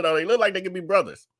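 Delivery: normal talking voice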